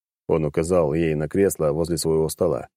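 ordinary delivery, nothing marked

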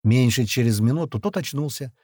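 usual speaking voice

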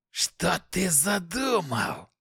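slow, high suspicious demon hiss; suspicious demonic hiss. Acted. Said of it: "с подозрением прошипел демон".

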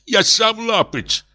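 very high, emotional outcry; exclaiming. A narrator's reading. Professor insults himself before the others, exclaiming high. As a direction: cry out, pitch climbing. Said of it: "воскликнул профессор".

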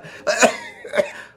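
a sudden shrill high cackle; high shrieking laugh. Acted. Judith laughs at the mock strangling, high shrieking laugh.

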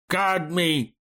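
high, plaintive wail; tearful voice. A narrator's reading. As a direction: wail it like a weeping artist mourning the paints. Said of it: "плачущим голосом художник". (плачущий голос, высоко и жалобно)

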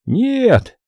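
high, drawn-up "no"; touching echo. A narrator's reading. Two agents answering the Austrian together, a touching echo. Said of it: "трогательным эхом ответили они".

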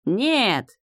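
high, hammed-up shout of no; theatrical cry. A narrator's reading. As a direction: cry out with fake drama. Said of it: "крикнул светловолосый мужчина". (театральный крик)

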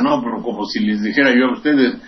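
ordinary talk, nothing marked

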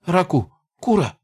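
rubbery high squeaky toy voice; a panicked goblin squeak. Acted. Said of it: "пропищал он".

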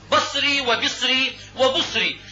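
بصري said three ways: basri, bisri, busri; vary only the first vowel of بصري: a, then i, then u; slow list of بصري with fatha, kasra, damma on the ب. Shown as a demonstration. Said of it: "والبصرة مثلثة الباء".

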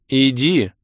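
loud imperious command; commanding goddess. A narrator's reading. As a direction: order it with divine authority. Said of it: "Власть в голосе богини просто зашкаливала".

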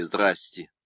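a sly Lenin greeting; Lenin's manner. A narrator's reading. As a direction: say «Здрасте» the way Lenin would. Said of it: "выговорил по-ленински".

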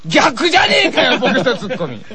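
normal talking voice

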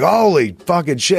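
high, drawn-out retold cry of alarm; mortified outburst. Man exposed before a room full of people, mortified. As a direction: draw it out high in comic horror. Shirt yanked up before a roomful.

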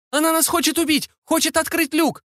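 very high, urgent alarm; high-pitched panicked woman; panicked cry for help. A narrator's reading. Crying for help in danger.

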